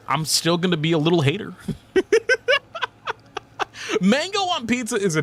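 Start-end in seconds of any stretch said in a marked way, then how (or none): 1.6-3.1 sudden high laugh breaking out; high burst of laughter. Real, not acted. Laughing at calling yourself a little hater. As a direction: laugh it off at your own joke.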